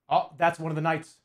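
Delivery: ordinary delivery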